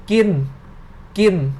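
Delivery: "Gin" said the Thai way, final n barely there. Shown as a demonstration. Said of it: "Like this".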